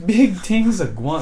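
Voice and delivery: slow, unsure Jamaican patois try; hesitant Jamaican patois. Retold or imitated. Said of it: "I don't think I can say that".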